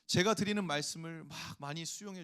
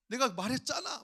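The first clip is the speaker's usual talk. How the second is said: louder, higher told-you-so; gloating outburst; 6 semitones higher, 6 dB louder than usual. Retold or imitated. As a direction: throw the blame back triumphantly. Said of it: "이런 마음이 내 속에서 막". (의기양양하게 외치는 말투)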